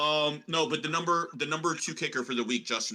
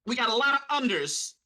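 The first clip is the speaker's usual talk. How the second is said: put-on hammy prospector accent, pitched up; Deuce Chiggins; 5 semitones higher than usual. Co-host reads his under bets to the panel, prospector voice. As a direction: play a high-voiced old-time prospector rattling off bets. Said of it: "I don't know what accent I'm doing".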